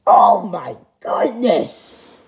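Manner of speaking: slow, drawn-out astonishment after a gasp; gasp, then stretch out the shock. Acted. Parent shocked at changed son.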